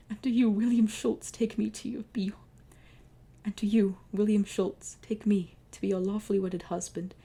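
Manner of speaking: lightly voiced pharaoh vowing; earnest pharaoh vows. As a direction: declare the vows with feeling. Pharaoh speaks wedding vows.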